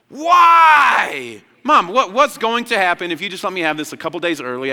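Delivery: high, loud wail, then fast pleading; mock a desperate child begging mom; whining impatient kid. Acting out impatient kids pleading with mom.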